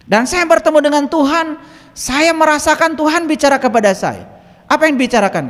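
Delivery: high, loud, ringing proclamation; preaching at full force. Acted. Preacher telling a friend's testimony to the congregation, full-force preaching.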